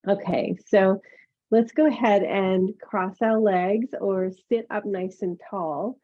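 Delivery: usual speaking voice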